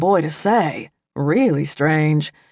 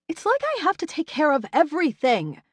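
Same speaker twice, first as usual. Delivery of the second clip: high, softer, clipped snap; snapped; 11 semitones higher, 6 dB quieter than usual. A narrator's reading. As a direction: bite the words off in irritation. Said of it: "she snapped at".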